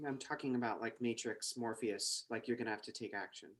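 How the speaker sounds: low hushed mysterious woman. Acted. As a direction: speak low and secretive, like a mysterious agent. Trinity voice continues.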